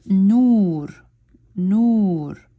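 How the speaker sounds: nur with a long drawn u; Arabic word nur. Shown as a demonstration. Teacher showing a long vowel to learners, nur drawn out. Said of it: "When I'm looking at nur".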